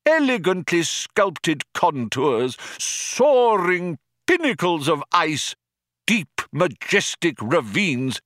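put-on high, slow, proud old craftsman; passionate old artist. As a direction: lovingly defend your masterpieces. Old man defends his art.

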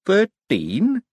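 high, dazed quaver; feeble, bewildered guess. A narrator's reading. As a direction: answer uncertainly, voice wobbling up. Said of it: "he quavered".

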